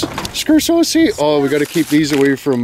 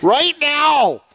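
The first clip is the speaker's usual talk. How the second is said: much higher, drawn-out summons; insistent call; 13 semitones higher than usual. Real, not acted. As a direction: call him over with a high, long yell. Summoning Tommy at once.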